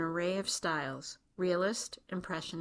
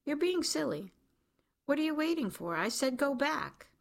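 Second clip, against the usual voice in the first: higher-pitched, exasperated scolding; 8 semitones higher than usual. A narrator's reading. Cece ordering Garrett back to the car, exasperated. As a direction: scold him with mild exasperation, voice raised in pitch. Bickering at the locked door.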